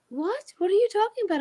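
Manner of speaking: high-pitched, baffled protest; incredulous. Retold or imitated. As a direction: re-enact challenging him in disbelief, voice lifted. Incredulous challenge to Jerry.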